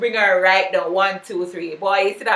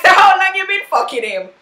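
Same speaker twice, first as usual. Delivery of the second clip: high, louder, sneering friend; mocking Keisha; 8 semitones higher, 5 dB louder than usual. Keisha confronting Tasha about Sean, high and louder. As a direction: press her like a nosy friend who knows. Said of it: "Keisha's like".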